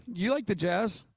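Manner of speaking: ordinary delivery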